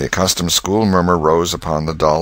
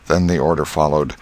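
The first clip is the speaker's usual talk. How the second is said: plain reading voice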